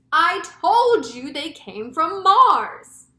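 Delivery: loud, high, slow exclamation; drawn-out vindicated yell; crying out. A narrator's reading. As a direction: shout it as I-told-you-so. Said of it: "cried the chief of police".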